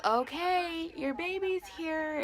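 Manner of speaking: high, singsong retold announcement; announcing the baby. Doctor telling the new mother her baby's here, high singsong. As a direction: announce it lightly, high and drawn out.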